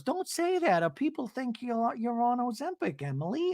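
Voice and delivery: high, soft warning impression; mock Gina. Host mimicking Gina scolding Emily, high and hushed. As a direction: hush her in a high voice. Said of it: "And then Gina goes".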